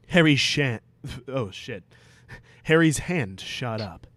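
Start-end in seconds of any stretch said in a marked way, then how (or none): none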